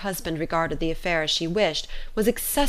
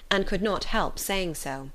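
ordinary delivery

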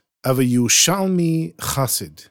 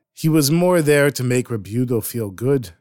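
ordinary delivery, nothing marked

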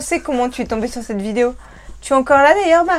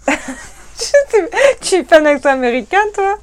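amused, higher-pitched through laughter; laughing; 6 semitones higher, 4 dB louder, slower than usual. Real, not acted. Reacting to partner's American accent, laughing. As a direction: laugh while you speak.

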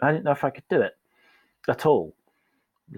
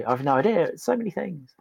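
higher, flustered and exasperated; flustered overwhelm; 6 semitones higher than usual. Retold or imitated. Colleague asked to pick one, flustered. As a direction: sound lost and flooded. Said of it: "they'd say".

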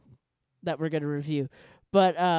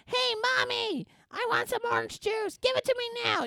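bratty Angelica. Retold or imitated. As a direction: whine it like a spoiled child. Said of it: "then she's like".

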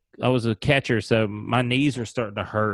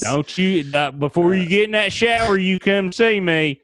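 mock creepy coach; 9 semitones higher, 4 dB louder than usual. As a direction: coax him over like a sleazy coach. Acting pervy coach beckoning.

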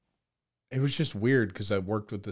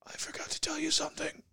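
whispering; 8 dB quieter than usual. Retold or imitated. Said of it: "he closes his eyes and he goes".

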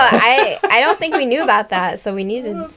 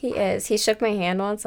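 ordinary delivery

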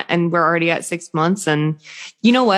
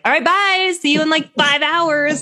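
much higher, chirpy goodbye voice; breezy drop-off goodbye; 11 semitones higher than usual. Acted. Mom acting out leaving her baby at daycare, high breezy goodbye. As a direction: toss off a high-pitched mock-casual bye. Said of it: "all of a sudden it's like".

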